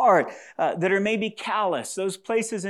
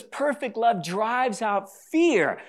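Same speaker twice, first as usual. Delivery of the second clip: slow scripture quote; 6 semitones higher, much slower than usual. Acted. Quotes verse again, slow.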